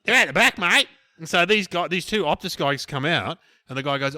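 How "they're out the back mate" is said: gruff bloke brushing him off. Retold or imitated. Bloke telling him to wait.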